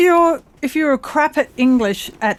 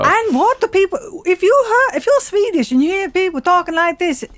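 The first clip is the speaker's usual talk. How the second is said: high, lilting put-on Scandinavian Norwegian accent; mock Norwegian accent; 6 semitones higher than usual. Shown as a demonstration. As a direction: ask in a singsong fake Norwegian. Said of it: "is that how Norwegians talk".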